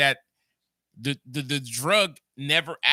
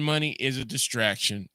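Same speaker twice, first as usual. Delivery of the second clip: ordinary talk, nothing marked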